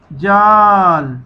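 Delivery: जाल stretched very slowly as one joined word; slow blend. Shown as a demonstration. Teacher modelling the joined word for pupils, slowly. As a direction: hold the long आ of जाल, then land on ल. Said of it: "इकट्ठा पढ़ना है".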